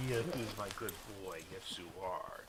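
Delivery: quiet cooing to a dog. Real, not acted. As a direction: coo softly to your pet.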